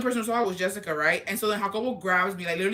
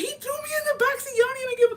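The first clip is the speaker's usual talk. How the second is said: much higher, louder, near-shrieking complaint; outraged wail; 3 dB louder, faster than usual. Retold or imitated. On the phone to Jessica, a high betrayed wail. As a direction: wail it at your friend, betrayed.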